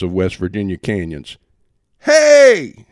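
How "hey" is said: high, loud bellowing demand; demanding shout. A narrator's reading. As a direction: roar it out like a defiant union boss. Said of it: "he demanded, in a voice like John L. Lewis defying the Supreme Court".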